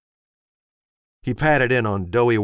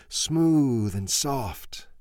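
slow dreamy; 4 semitones higher, much slower than usual. A narrator's reading. Ben's chant slows down.

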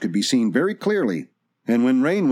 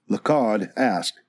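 plain reading voice

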